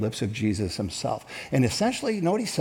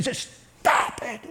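mock angry stop it; 9 semitones higher than usual. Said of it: "was he shouting? Was he just angry?".

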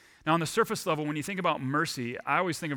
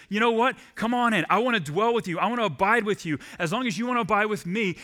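welcoming call; 6 semitones higher, 4 dB louder than usual. Acted. God's welcome voiced in sermon.

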